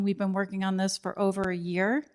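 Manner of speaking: usual speaking voice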